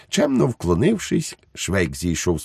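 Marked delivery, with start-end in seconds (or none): none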